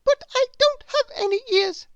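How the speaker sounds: high squeaky little bird voice; very high small bird voice; Chirp the bird. A narrator's reading. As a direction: pipe it up high like a tiny bird. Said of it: "said Chirp".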